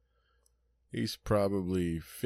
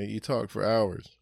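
ordinary talk, nothing marked

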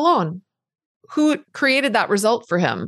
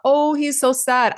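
higher, slow, lightly mock pitying sigh; pitying thought; 6 semitones higher than usual. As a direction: drawl out the pity, higher and slow. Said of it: "you're gonna constantly have this thought".

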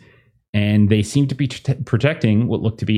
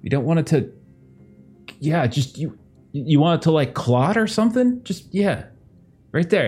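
a hint of a higher, hesitant stranger; scrawny guy voice; 6 semitones higher than usual. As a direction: offer help awkwardly. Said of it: "He's like".

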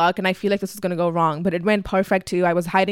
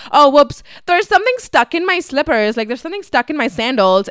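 louder, higher-pitched put-on excuse; mock surprise; 6 semitones higher, 5 dB louder than usual. Dad stalling mom with a sandal excuse, exaggerated surprise. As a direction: play up a sudden fake discovery. Acting out dad's fake excuse.

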